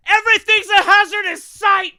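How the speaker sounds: high, loud exasperated shout; exasperated yell. Real, not acted. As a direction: yell it in disbelief. Exasperated outburst at the video.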